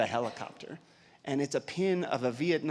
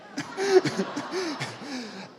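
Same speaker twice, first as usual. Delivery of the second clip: laugh; 14 semitones higher, 3 dB louder than usual. Real, not acted. Laughs at own story.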